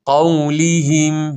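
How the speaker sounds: slow chanted قولهم with spread-lip "lii"; drawn-out, clear i in قولهم; model recitation. Shown as a demonstration. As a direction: stretch قولهم as "qawliihim". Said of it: "به این صورت".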